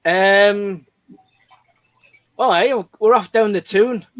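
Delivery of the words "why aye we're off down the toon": Scottish attempt that lands as Geordie; attempted Scottish accent. Shown as a demonstration. Parent asked for Scottish, comes out as Geordie accent. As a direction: try Scottish, slipping into Geordie. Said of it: "So, can you do one?".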